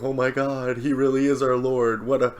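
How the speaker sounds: high, breathless hero-worship gush; gushing fan awe. Retold or imitated. Samurai swooning over their young lord, high excited gush. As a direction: gush like starstruck retainers.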